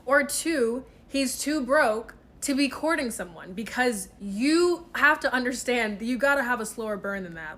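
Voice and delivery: normal talking voice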